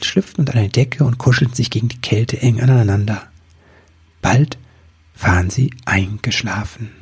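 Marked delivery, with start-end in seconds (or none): none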